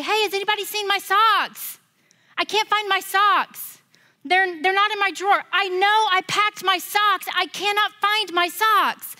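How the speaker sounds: high, loud, frantic searching; panicked teammate voice; frantic girl. Retold or imitated. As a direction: call out in a panic for your socks. Girl searching for lost socks.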